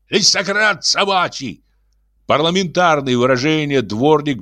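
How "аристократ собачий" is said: shouting. A narrator's reading. Janitor cries out to the crowd, mocking. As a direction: hurl the title like an insult. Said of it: "вскрикивал дворник".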